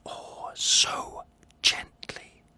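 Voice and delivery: slow, soft whisper; creeping at midnight, whispered. Acted. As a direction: breathe it out gently. Opening the old man's door.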